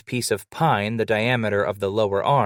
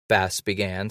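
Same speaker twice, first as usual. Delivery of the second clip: ordinary delivery, nothing marked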